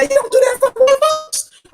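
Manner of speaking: high-pitched scandalized scolding; old-time admonishing. Retold or imitated. How we used to say it in the day, high mock admonishment. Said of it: "how we used to say that in the day".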